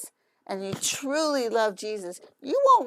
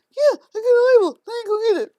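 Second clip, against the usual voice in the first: high, loud put-on horse voice; goofy horse voice; 12 semitones higher, 9 dB louder, much faster than usual. Owner voicing horse's reply.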